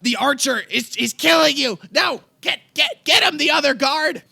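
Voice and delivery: loud, very high panicked shouting; horrified king. Acted. King Ark to his guards as one dies, horrified yell. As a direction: scream desperate orders, stammering in horror. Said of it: "horrified and says".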